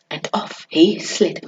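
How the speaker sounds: plain reading voice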